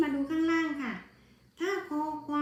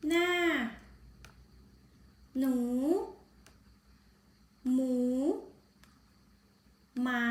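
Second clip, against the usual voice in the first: read-along word list. Shown as a demonstration. Teacher reading words one by one for the class to repeat. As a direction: give each word clearly for pupils to repeat. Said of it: "นักเรียนอ่านตามนะคะ".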